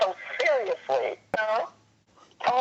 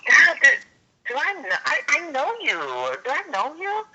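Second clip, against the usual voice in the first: curious; 7 semitones higher than usual. Retold or imitated. Stranger half-recognizes her.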